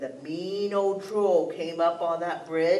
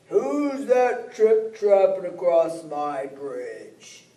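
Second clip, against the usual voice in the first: slow, louder nasty troll voice; mean old troll; 6 dB louder, much slower than usual. Acted. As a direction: growl it out slowly like a nasty troll. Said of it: "came a mean old nasty voice".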